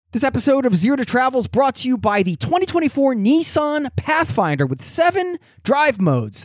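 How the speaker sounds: high, bright car ad voice; ad read. Acted. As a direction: sell it like a radio spot.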